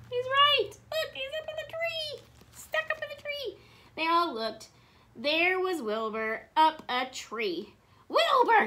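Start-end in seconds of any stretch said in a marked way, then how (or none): 0.1-3.6 very high, squeaked exclamations; astonished discovery. A narrator's reading. Elephants discover Wilbur in the tree, high amazed cries. 8.1-8.7 high, loud startled exclamation; shocked gasp. A narrator's reading. Elmer spots cousin up a tree, loud high gasp. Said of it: "gasped Elmer".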